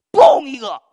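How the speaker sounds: a sudden loud "嘣" like an impact; explosive bang sound; loud crash sound. Retold or imitated. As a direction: burst out the crash noise. Crash of the motorbike.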